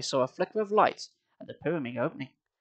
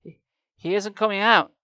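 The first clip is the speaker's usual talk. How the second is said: stammering; 5 semitones higher, 4 dB louder than usual. A narrator's reading. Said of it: "Sari stammered".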